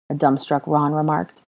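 plain reading voice

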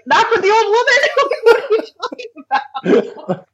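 high-pitched, laughing outraged retort; squealed through helpless laughter; laughing disbelief. Retold or imitated. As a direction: protest incredulously while cracking up. Incredulous retort retold through laughter.

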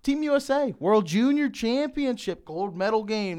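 big hyped-up announcement, high and loud; excited hype. Real, not acted. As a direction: sell it like a big game. Hyping the gold medal game.

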